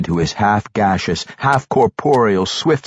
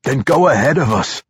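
raised, louder urgent voice; a bit desperate; 7 semitones higher, 5 dB louder than usual. A narrator's reading. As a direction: urge him on with a touch of desperation. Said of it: "his voice raising in a bit of desperation".

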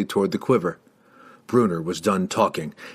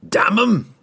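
high, sudden dwarf outburst; sudden roar; 10 semitones higher, 4 dB louder than usual. A narrator's reading. Dwarf loses patience with the riders, roaring. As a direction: roar it out abruptly. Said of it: "Bruenor roared suddenly".

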